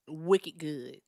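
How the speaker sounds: put-on Boston slang, drawn out; Boston accent. Shown as a demonstration. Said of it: "here's my Boston accent".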